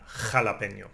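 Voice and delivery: jalapeño with the j scraped in the throat; jalapeño as xa-la-PEH-nyo; Spanish jota. Shown as a demonstration. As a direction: rasp the j of jalapeño. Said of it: "Scraping your throat again".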